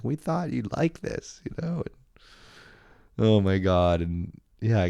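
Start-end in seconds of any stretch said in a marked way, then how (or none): 0.0-1.4 high, slow, sugary quote; sweet givers quoted. Retold or imitated. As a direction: mimic their sweet gift-giving tone. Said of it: "They're like".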